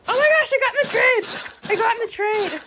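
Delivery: thrilled squeal at a trade finally going through; excited. Real, not acted. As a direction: blurt it out thrilled. Trade suddenly accepted.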